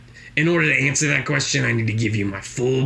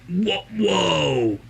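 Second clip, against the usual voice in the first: mock-impressed, higher voice; impressed stranger; 8 semitones higher than usual. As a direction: play the charmed new acquaintance, pitched up. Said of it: "and they're like".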